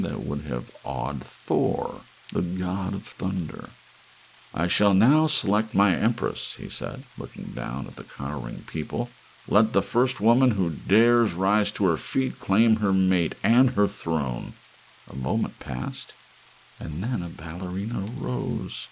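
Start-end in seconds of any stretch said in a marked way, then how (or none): 4.5-6.5 grand. Acted. Emperor addresses cowering people. 9.5-14.5 proclaiming. Acted. Emperor proclaims to the crowd.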